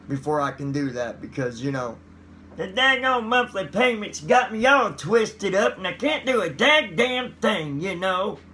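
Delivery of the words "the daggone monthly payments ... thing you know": high, louder hillbilly complaint; hillbilly accent. Pretend. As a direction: grumble in a goofy hillbilly twang. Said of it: "There goes me acting goofy again".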